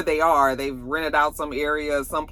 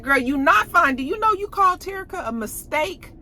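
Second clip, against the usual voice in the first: confronting; 13 semitones higher, 3 dB louder than usual. Retold or imitated. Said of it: "Mercedes was like".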